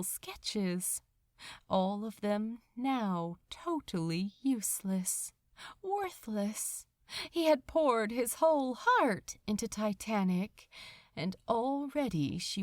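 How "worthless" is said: usual speaking voice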